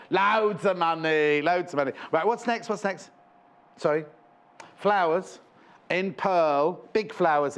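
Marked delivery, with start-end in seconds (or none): 0.1-1.4 slow, stretched put-on voice; hammed-up slow Loadsamoney; Harry Enfield catchphrase. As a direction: draw out every word like a show-off. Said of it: "Harry Enfield".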